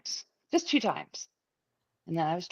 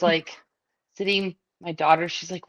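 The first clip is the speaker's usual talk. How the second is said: usual speaking voice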